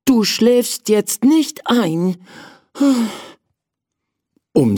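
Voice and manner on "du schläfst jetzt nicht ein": high, firm self-warning; prince scolding himself. Acted. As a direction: shake himself awake. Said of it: "sagte er zu sich".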